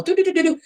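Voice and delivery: high sing-song doo-doo-doo; imitated computer noises. Describing her crowded brain, high imitated computer noise. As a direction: patter like a busy machine, high-pitched.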